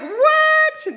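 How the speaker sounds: squeaky high, surprised "What?"; a stunned, delighted yelp of disbelief; amazed what. Retold or imitated. Excited about six and a half gallons of milk, high astonished squeak. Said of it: "I've been so excited all day, like".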